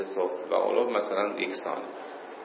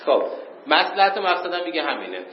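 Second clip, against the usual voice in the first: ordinary delivery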